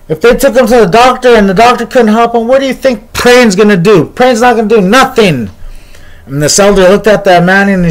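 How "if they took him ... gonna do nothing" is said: higher, heated bystander voice; scornful arguing. Retold or imitated. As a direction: argue it with acted scorn, pitched up. Scoffing man argues against prayer.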